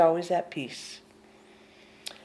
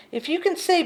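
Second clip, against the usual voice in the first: ordinary talk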